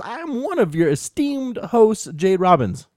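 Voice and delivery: upbeat show-host welcome; podcast intro. Acted. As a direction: announce the show brightly.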